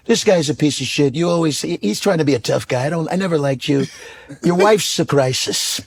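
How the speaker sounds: put-on Trump voice, brash and insulting; Trump impression. Comedian imitating Trump insulting a debate rival. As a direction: bluster like Trump losing an argument. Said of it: "He'd be like".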